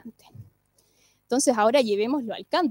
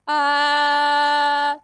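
ringing bright ah; bright twang ah; 4 dB louder than usual. Shown as a demonstration. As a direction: keep the twang ring. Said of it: "jugar con el brillo".

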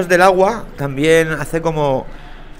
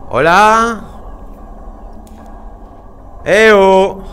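calling out; 5 semitones higher, much slower than usual. Real, not acted. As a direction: keep calling into the dark. Calling out in dark game. (llamadas a gritos)

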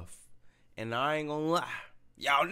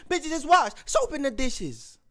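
higher, put-on [name] accent; imitating [name]'s accent; 6 semitones higher than usual. Fan repeating [name]'s lyric, higher put-on [name] accent. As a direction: do her accent like you mean it. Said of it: "Trying to say what her accent".

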